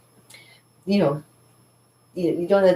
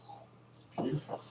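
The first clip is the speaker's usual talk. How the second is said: breathy awed whisper; 18 dB quieter, faster than usual. Retold or imitated. Awe at morning water.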